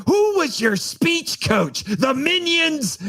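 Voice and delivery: high, slow mocking voice; a mocking high Minion-ish voice. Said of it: "Eric could be like".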